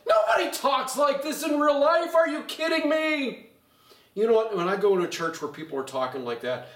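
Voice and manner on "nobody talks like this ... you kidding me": high, loud protest; worked-up acted reaction; incredulous outburst. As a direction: shout it in exasperated disbelief. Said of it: "and you're like".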